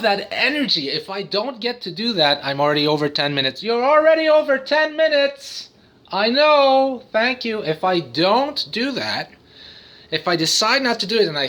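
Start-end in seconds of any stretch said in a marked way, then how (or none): 3.6-5.7 high put-on nagging voice; playful high-pitched scolding; mock scolding voice. Scolds himself in other voice. 6.1-7.6 high, playful put-on reply; hammed-up high acknowledgement; mock answering voice. Answers his own scolding voice.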